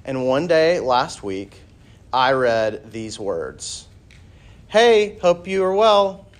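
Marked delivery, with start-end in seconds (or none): 4.7-6.3 high, loud chirpy greeting; over-cheery message voice, pitched up; exclamatory text message. Acted. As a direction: read it out with a big exclamation mark. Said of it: "Exclamation mark".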